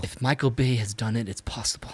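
unvoiced secret whisper. Real, not acted. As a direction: breathe it as an aside. Whispered joke.

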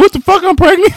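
high, loud voiced mother dog; mock shocked dog. Friend voicing the pregnant Chihuahua in a high shocked voice. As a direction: squeal it in shock. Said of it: "She gonna be like".

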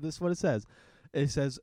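ordinary talk

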